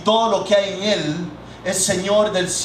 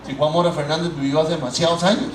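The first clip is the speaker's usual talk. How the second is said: puzzled listener; 5 semitones lower, faster than usual. Pretend. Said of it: "Y ustedes dijeran".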